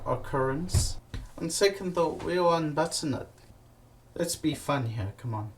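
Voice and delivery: ordinary talk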